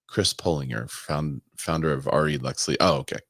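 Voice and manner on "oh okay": normal talking voice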